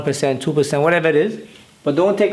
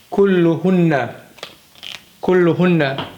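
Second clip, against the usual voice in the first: ordinary talk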